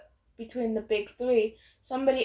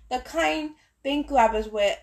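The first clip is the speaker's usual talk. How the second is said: ordinary delivery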